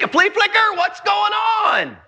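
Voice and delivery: high-pitched bewildered questioning; acted-out frustrated prisoner demanding answers; John, exasperated. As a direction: build to a drawn-out cry of disbelief. Acts John's bewildered questioning of Jesus.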